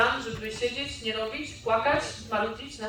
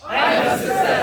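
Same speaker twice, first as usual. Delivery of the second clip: crowd shouting it back; booming motivational speech; 7 semitones higher, 13 dB louder than usual. Shown as a demonstration. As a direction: yell the affirmation at full force. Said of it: "repeat after me".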